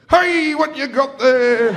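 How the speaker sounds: high, slow falsely casual dad; dad pretending it's nothing; nervous dad. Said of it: "where he goes".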